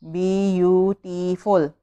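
beautiful broken into four slow beats; beautiful syllable by syllable. Shown as a demonstration. Teacher counting four syllables for pupils, beautiful slowly split up. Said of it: "there are four syllable".

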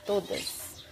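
soft whispered repeat of todas; hushed echo of todas. Real, not acted. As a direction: breathe the word todas as an afterthought. Whispered echo of own words.

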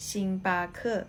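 "ชิงบาเคอ" said as Mandarin xing-ba-ke with a rising end; "ชิงบาเคอ" in Chinese tones; Mandarin reading. Shown as a demonstration. Teacher giving the Chinese name for Starbucks, Mandarin tones. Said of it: "จะอ่านว่า".

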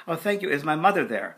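eager. Retold or imitated. Overwhelmed asking for his mother.

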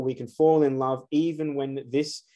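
ordinary talk, nothing marked

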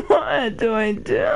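usual speaking voice

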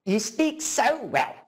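high sing-out on the name, then slow praise; gushing, patronizing auntie; imitated auntie. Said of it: "That's what she'll say".

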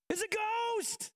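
high, slow drawn-out panicked cry; terrified shriek. Acted. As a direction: wail it like you just saw a ghost. Disciples terrified, acted out.

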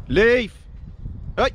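loud high command to the dog; sharp command. Real, not acted. Owner stopping his dog chasing a pheasant, loud command. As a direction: snap it out to stop her.

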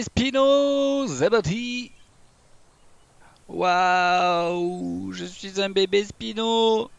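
put-on high, squeaky baby creature voice; baby dinosaur voice. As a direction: play a tiny baby dinosaur. Playing as baby Spinosaurus.

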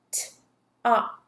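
"T-op" as separate t, o, p sounds; sounded out. Shown as a demonstration. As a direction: tap out "T-op" with gaps.

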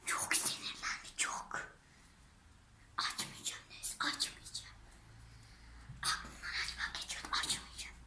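hushed unvoiced whisper. Real, not acted. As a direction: whisper to the camera. Aside to viewers after quarrel.

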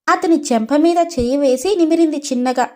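usual speaking voice

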